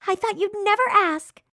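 high, thrilled cartoon girl; delighted yes. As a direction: gush with delight at the invite. Girl happily accepts the date.

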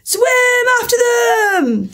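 very high, drawn-out scream. A narrator's reading. Gryphon calls the next dance step, screaming. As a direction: scream it out, stretching each word. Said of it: "screamed the Gryphon".

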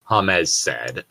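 ordinary talk

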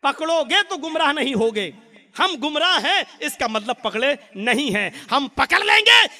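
loud, fervent sermon call; preaching at full force. Acted. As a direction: rouse the congregation. Preacher calls people to Quran and Sunnah. (जोशीली तक़रीर, ऊंची आवाज़ में)

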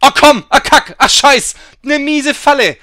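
loud, high angry yelling; furious outburst. Real, not acted. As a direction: explode at the trap. Raging at failed run.

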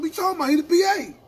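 pushed-up, argumentative retelling of a retort; incredulous retort. Retold or imitated. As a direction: throw it back at him, voice jumping up. Acted retort in retold argument.

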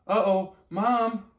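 high, loud, drawn-out "Uh-oh, Mom!"; a small acorn losing his grip, crying out to his mother; alarmed cry. Acted. Acorn slipping off branch.

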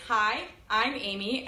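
normal talking voice

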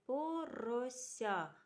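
Порося drawn out at half speed; teacher segmenting a word for pupils; slow sound-by-sound. Shown as a demonstration. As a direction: stretch Порося so each sound is heard.

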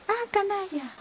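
un grito agudo de rabia, más alto; la zorra le grita furiosa a su rabo; grito de enfado. Acted. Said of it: "gritó la zorra". (la zorra insulta a su propio rabo, grito agudo)

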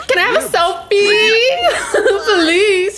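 high, slow, laughing beg; pleading fangirl. Retold or imitated. Fan asking [name] for a selfie, high giggling plea.

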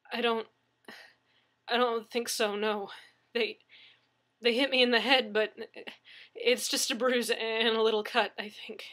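halting answer with a touch of fear; shaken hesitation. A narrator's reading. Injured victim answering the dispatcher, mildly shaken. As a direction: falter and trail off uncertainly.

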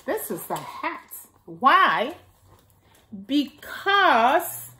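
gentle storybook reading for little ones; reading to children. Acted. Miss Nana reading Little Cloud aloud to the children, storybook voice. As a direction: read it out like story time for kids. Reads picture book to children.

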